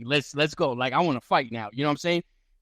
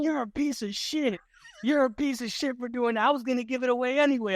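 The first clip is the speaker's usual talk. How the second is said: high mock whiny tirade, quicker at the end; imitating angry Chucky; 10 semitones higher than usual. Rival fighter whining at him, high mock tirade. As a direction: whine the insult like a sore loser.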